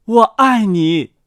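slow, firm declaration at moderate volume; declared aloud. A narrator's reading. Mute boy takes girl's hand, declaring slowly. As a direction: say it out deliberately, only mildly projected. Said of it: "大声说".

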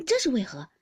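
loud, urgent query; hurried question. A narrator's reading. Baoyu anxiously questioning his friend's departure, urgent. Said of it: "忙问道".

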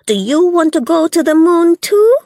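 high, loud taunting woman; mocking Belle. A narrator's reading.

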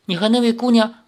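high, tentative question; suppressed unease. A narrator's reading. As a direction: ask carefully, holding worry back. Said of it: "强忍着不安问道". (强忍不安地试探)

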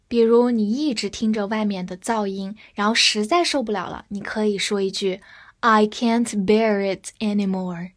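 normal talking voice

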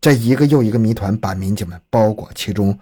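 ordinary delivery, nothing marked

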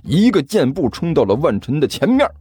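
ordinary delivery, nothing marked

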